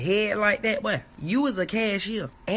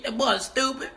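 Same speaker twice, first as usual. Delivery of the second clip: ordinary talk, nothing marked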